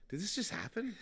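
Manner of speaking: high-pitched, stunned question; mock-incredulous squeak; acted disbelief. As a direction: pitch it way up, as if baffled. Acted disbelief as patient runs off.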